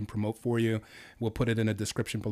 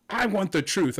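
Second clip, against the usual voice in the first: a crying, pleading demand, pitched higher; tearful plea; 7 semitones higher, 3 dB louder than usual. Pretend. Host voicing Tom Cruise in a game, pleading through tears. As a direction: beg for the truth through tears. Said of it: "if Tom Cruise said to me".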